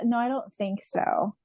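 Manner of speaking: usual speaking voice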